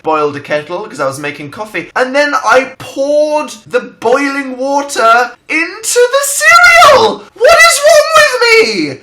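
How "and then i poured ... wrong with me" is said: very high, very loud crying shout; mock anguished wail. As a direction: wail the disaster at full volume. Despairing over boiled cereal.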